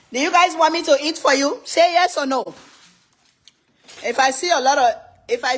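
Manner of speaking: high, loud, eager asking; rally the chat loudly; excited call to viewers. Real, not acted. Host asking live viewers to vote, excited.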